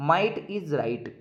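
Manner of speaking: "Might is right" drawn out very slowly, each word spaced apart; slow dictation. Shown as a demonstration. Teacher dictating a proverb for the exam, very slow. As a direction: spell out "Might is right" for copying. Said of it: "तो आपको मैं तीन कहावतें बता देता हूँ".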